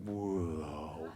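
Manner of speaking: low, soft deflating droop; deflating balloon imitation. Speaker imitates a burst of enthusiasm deflating, deflating balloon imitation. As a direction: let it sag down like an emptying balloon. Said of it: "your balloon is like".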